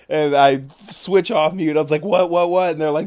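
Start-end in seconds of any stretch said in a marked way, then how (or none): 2.0-2.7 high, loud, hurried demand; retold urgent questioning. Player switching off mute to ask, urgent mock shout. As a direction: act out rushing back to find out. Rushing back to the shouting group.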